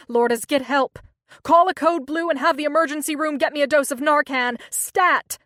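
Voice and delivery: loud, commanding emergency orders; urgent shouting. A narrator's reading. Doctor barks emergency orders.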